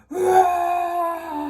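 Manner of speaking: loud, high bear roar; great big roar. A narrator's reading. Papa Bear roars at Goldilocks, loud and wild. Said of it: "a great big roar".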